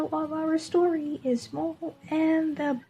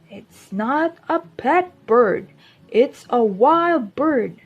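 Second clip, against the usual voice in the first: a louder, firmer dad correcting his son; a hint of a stern father; 9 dB louder than usual.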